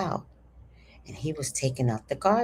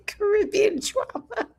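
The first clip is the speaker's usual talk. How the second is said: high laughing through the words. Real, not acted. Laughing at the Caribbean drama next door, high. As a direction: cackle at the neighborhood drama.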